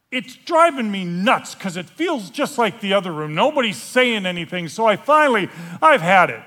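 loud frustration building to bursting; exasperated. Acted. Fed up with the silent room, loudly exasperated.

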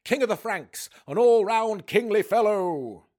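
high, loud ceremonial announcement; royal herald. Acted. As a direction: proclaim the king's entrance grandly. Announces the king's entrance.

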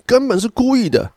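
very high-pitched, put-on outraged voice; angry retort. Furious parent snaps at noisy neighbors, high-pitched acted outrage. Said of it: "还呛说".

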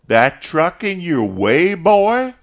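loud, high mocking question; taunting. A narrator's reading. Caleb challenging Mister Morrison about the truck, loud taunt. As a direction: needle him, pitch soaring at the end.

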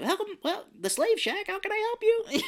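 put-on very high childlike voice; kid voice. Kid reading the phone greeting in a very high child voice. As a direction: pitch way up like a little boy. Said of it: "this kid's all like".

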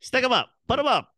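high, loud mock gangster; gangster stick-up. Friends riffing on old movies, hammed-up gangster stick-up. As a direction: bark it like a cartoon robber. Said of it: "Dick Tracy".

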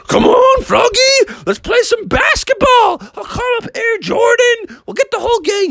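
very high, eager bull voice; excited bull. Acted. Benny the Bull begs Froggy to play basketball, excited. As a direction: whoop it up high, rallying a pal.

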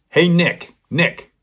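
high, loud call from the doorway; a player turning at the door to call to the boy; calling back. Retold or imitated. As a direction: stop at the door and call his name. Player stops, calls back at door.

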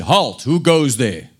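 slow stern guard's challenge; commanding guard. A narrator's reading. Guard challenging the intruders, lightly voiced command. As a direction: call out like a castle guard. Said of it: "he commanded".

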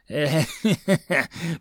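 short mocking goblin laughter; goblin cackle. A narrator's reading. Goblin head laughs in dream.

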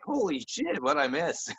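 high, stunned exclamation; amazed. Retold or imitated. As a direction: blurt it out in disbelief. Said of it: "I was like".

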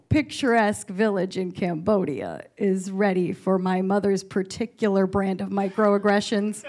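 ordinary talk

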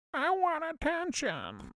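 high, slow whiny plea for attention; needy girl voice. Retold or imitated. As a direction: whine it like a spoilt girl.

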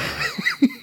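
big sigh of relief; dramatic sigh. Real, not acted. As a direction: let out a huge relieved sigh. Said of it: "big sigh of relief".

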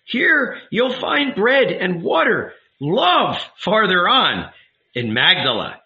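put-on high, slow old village woman; old lady voice. A narrator's reading. Old lady's voice in story.

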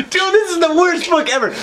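laughing outburst. Real, not acted. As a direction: explode laughing then condemn the book. Bursts out laughing.

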